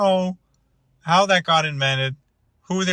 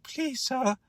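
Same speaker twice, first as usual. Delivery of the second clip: high, plaintive mock orphan begging; slow, high-pitched, softer pleading; old-timey movie pauper; 13 semitones higher, 5 dB quieter, slower than usual. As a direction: beg like a poor waif in an old film. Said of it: "like, old-timey movies".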